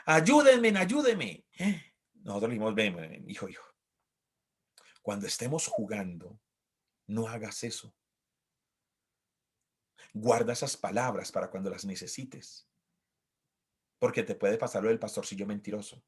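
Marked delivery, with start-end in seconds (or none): 0.1-1.4 put-on child crying help. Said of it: "tenía la costumbre de decir". 2.7-16.0 gentle parent. Retold or imitated. Parent gently correcting child.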